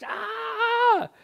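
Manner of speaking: sudden high panicked yelp; a short high shriek of panic; panicked scream. Retold or imitated. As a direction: cry out like terrified men in the storm. Disciples panicking in storm.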